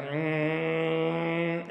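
a short buzzing hum out the nose; nasal hum. Shown as a demonstration. Teacher showing the hum for the growl, humming. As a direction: hum through the nose, mouth closed. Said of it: "you literally go".

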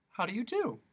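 high, gentle greeting; sweet old lady. Acted. Witch greets Milo politely, high and sweet.

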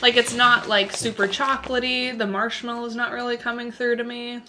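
talking while chewing; mouth partly full. Real, not acted. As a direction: donut held at lips. Seen 1: Woman holds a bitten donut piece at her mouth while talking.

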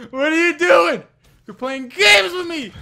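high, loud incredulous yell; agitated shout. Real, not acted. Player rattled by opponent's games, agitated shout. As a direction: demand it loudly, rattled.

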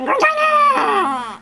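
long, drawn-out high shout; hammed-up, high hollering; playful holler. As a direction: stretch the name into a mock call. Calling out the shade's name playfully.

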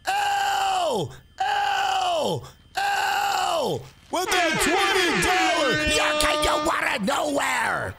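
cheering a donor's twenty dollars, shouting; hype shout. Real, not acted. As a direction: yell it like a stream hype man. Hyping a donor on stream.

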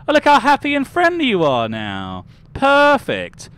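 high, loud cooing over a creation; gushing delight. Real, not acted. Streamer admires a newly made happy-go-lucky character, gushing. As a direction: gush over how cheery they look.

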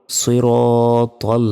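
সিরাতাল as soi-rooo-tol, heavy and drawn out; correct heavy letters. Shown as a demonstration. Teacher models it after correcting, slow heavy সিরাতাল. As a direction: round and hold the ro in সিরাতাল. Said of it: "এটাও ভুল".

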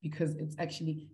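ordinary delivery

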